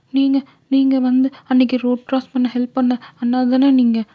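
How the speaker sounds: put-on high, small girl's voice, unsure; timid young girl. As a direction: recognise him hesitantly in a high girlish voice. Girl voice held. (தயக்கமான சிறுமி குரல்)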